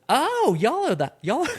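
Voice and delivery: high, slow, laughing West Texas drawl; thick West Texas accent. Retold or imitated. Lubbock mom greeting her son's friends in a high West Texas drawl. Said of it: "that real thick, you know, West Texas accent".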